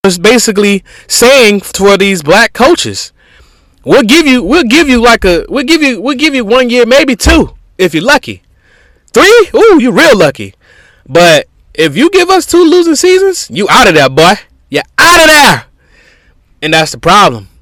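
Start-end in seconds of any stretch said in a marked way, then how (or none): 3.8-15.7 mocking owner voice. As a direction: talk down to the coach like a smug boss. Voicing team owners to coaches.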